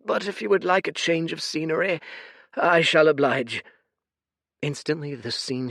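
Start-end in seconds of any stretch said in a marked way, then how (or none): 0.0-3.6 put-on high, tired old man voice; a sorrowful old oracle; weary old seer. Fate's voice.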